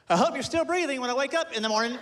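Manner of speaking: lightly voiced creepy son; creepy kid. Son at bedtime wishing dad still breathing, eerie. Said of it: "He looks up and goes".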